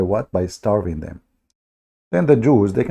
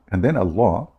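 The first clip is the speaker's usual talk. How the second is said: ordinary talk, nothing marked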